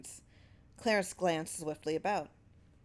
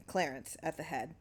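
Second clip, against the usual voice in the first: plain reading voice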